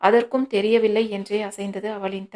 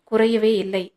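ordinary delivery

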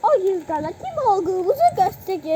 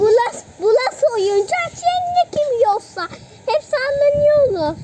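on a bumpy road; 5 semitones higher, 5 dB louder than usual. Pretend. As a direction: voice jolted by shaking, loud. Hep sallanıyoruz. (sarsıntılı yolda)

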